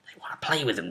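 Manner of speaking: conspiratorial whisper. Acted. GM teasing the players about the props, whispered. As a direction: lean in and breathe it secretly.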